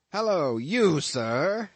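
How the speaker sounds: high, loud hail; commanding captain hailing a sailor; captain's cry. A narrator's reading. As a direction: call out sharply like a ship's captain. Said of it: "cried the captain".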